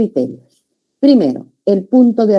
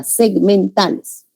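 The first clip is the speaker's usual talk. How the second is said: segmentales drawn out slowly, one part after another; segment by segment; 2 semitones higher, slower than usual. Shown as a demonstration. Teacher shows students sounds follow one another, segmentales articulated slowly in sequence. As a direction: say "segmentales" slowly, piece by piece in a line. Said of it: "Así se articula".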